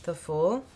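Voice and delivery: THE FOOL with a rising, questioning lift; questioning intonation. Real, not acted. Tarot reader reveals a drawn card, questioning intonation on "The Fool". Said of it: "said that like a question".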